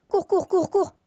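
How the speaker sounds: high urgent repeated run; panicked calls to run; being chased. Real, not acted. Fleeing creeper again.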